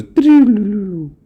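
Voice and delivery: high, falling trilled doo-doo run; imitated xylophone riff. Showing the track's opening sound, a high imitated riff. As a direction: mimic mallets tumbling down the keys. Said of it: "it goes".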